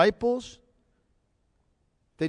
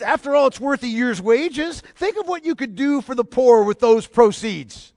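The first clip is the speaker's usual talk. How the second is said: higher, louder, scoffing complaint; mock indignant disciples; 8 semitones higher, 4 dB louder than usual. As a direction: sneer it like a self-righteous critic.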